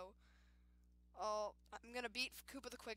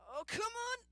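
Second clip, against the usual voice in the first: frustrated shout; 12 semitones higher, 6 dB louder than usual. Real, not acted. Frustrated at game.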